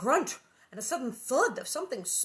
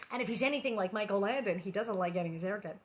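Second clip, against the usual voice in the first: usual speaking voice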